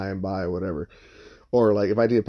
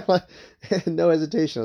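real chuckle breaking into the words; amused, laughing as you recount it; 6 semitones higher, 4 dB louder than usual. Real, not acted. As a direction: laugh through it, pitch lifted. Amused at own instant answer.

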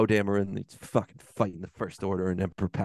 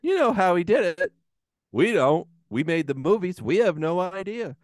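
higher-pitched, defiant sarcasm; mock filmmaker rant; 6 semitones higher than usual. Filmmakers tell ticket buyers figure it out, sarcastically defiant. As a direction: brush off the paying audience indignantly. Acting dismissive filmmakers scolding audience.

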